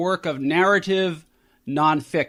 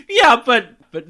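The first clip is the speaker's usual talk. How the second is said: laughing; 10 semitones higher, 7 dB louder than usual. Real, not acted. Host cracks up at impression.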